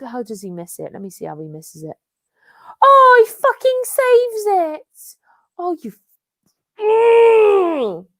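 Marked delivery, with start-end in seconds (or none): 2.8-8.1 high, loud wail of frustration; real furious, high-pitched cry; angry outburst. Real, not acted. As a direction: scream it out, dragging the words. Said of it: "Charlotte getting so angry at Chelsea".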